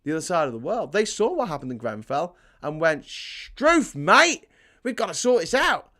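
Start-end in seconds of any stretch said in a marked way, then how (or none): 3.0-5.9 loud, high, badly faked Australian; terrible Australian accent. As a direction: do a hammed-up Australian, pitched way up. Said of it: "I'll never do an Australian accent again".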